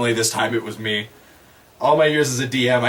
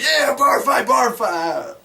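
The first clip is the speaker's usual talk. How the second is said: eager brawler; 11 semitones higher, much slower than usual. Acted. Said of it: "and then I'll be like".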